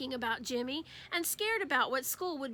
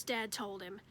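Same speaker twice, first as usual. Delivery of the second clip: plain reading voice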